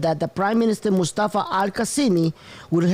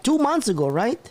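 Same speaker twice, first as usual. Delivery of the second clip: ordinary delivery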